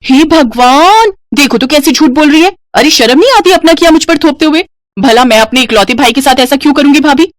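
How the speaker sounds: high-pitched offended innocence; indignant denial. Acted. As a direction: act outraged at being blamed. Sister-in-law feigns shocked innocence.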